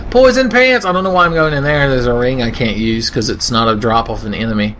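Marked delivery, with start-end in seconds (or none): 0.1-0.8 higher, louder excited cry of the name; excited exclaim. Pretend. Gamer shouting over loot, excited cry. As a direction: call it out with delight.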